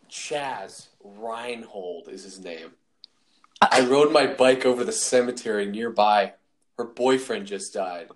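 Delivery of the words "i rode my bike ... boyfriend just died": quoted movie line. Acted. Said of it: "The scene goes".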